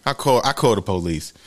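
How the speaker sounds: ordinary talk, nothing marked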